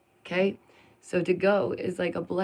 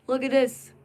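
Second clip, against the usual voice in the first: higher, eager look at this; excited showing; 6 semitones higher, 4 dB louder than usual. Real, not acted. Vlogger showing viewers a festival photo, excited. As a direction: call viewers over eagerly.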